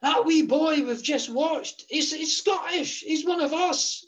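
put-on calling out high and excited in a Scottish accent; excited Scottish shout, pitched high; retold shout. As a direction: hail a friend across the room, Scottish accent.

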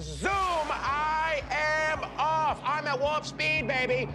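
very high, stretched-out whooping shout; soaring high-pitched stoned elation; ecstatic blast-off. Acted. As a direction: launch into a high, spaced-out holler. Blasting off after a puff.

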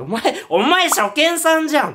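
high, loud accusing yell; menacing shout. Retold or imitated. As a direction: hurl the accusation. Ghost girl shouting.

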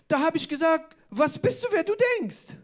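hammed-up high-pitched sass; mock indignant girl. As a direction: imitate a teen proudly retelling her retort. Girl retelling her comeback, acted.

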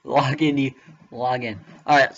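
ordinary talk, nothing marked

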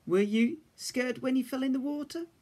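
gentle question. A narrator's reading. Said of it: "asked Big Bear".

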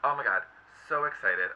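normal talking voice

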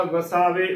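ਵਸਾਵੇ as "va-saa-ve"; wrong three-syllable reading. Shown as a demonstration. Teacher showing the congregation's mistake, ਵਸਾਵੇ as "va-saa-ve". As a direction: join e directly to the last v of ਵਸਾਵੇ. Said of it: "ਬਹੁਤ ਹੀ ਗਲਤ ਉਚਾਰਨ ਹੈ".

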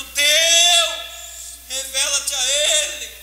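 loud then slow earnest plea; prayer called loudly, then slowed; fervent prayer. Retold or imitated. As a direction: pray it out with urgency. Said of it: "Eu tô orando".

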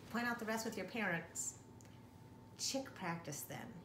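ordinary delivery, nothing marked

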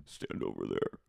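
very low, quiet command; deep put-on villainess order; Katie Maloney. Katie ordering him outside, low hushed menace. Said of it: "she goes".

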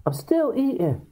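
lightly voiced husband, pitched high; a hint of a husband still eating; high mock husband. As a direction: answer up in a high reedy voice. Husband answers wife.

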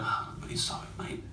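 breathy hushed whisper, voice breaking. Retold or imitated. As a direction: whisper the apology as if about to cry. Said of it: "I'm in a whisper at that point".

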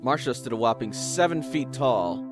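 usual speaking voice

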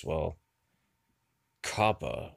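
normal talking voice

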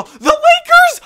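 high, loud shriek of disbelief; a real shocked squeal. Real, not acted. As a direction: scream the name in total shock. Gilbert's shocking offer revealed.

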